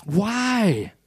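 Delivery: high, drawn-out falling WHY; demanding Pilate. Acted. Preacher as Pilate challenges the crowd, drawn-out demand. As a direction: cry out a long, protesting why. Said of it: "Pilate demanded".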